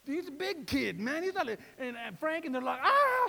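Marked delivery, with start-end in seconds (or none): 2.8-3.3 loud high mock shriek; imitated scream. Preacher imitates his tall sons screaming at a tiny mouse, loud high shriek. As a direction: shriek like a scared kid.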